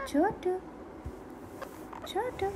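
high cooing call to a pet; high-pitched pet-calling coo; high sing-song baby talk. Acted. Coaxing pet parrot to talk.

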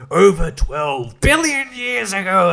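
drawn-out supervillain reveal; mock Riddler punchline. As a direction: deliver the riddle answer with mock gravity. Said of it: "Riddler's not like".

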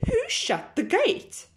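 high squawky goose question; indignant geese. Acted. Geese squawking about the open gate. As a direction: squeak it up high like a cross goose.

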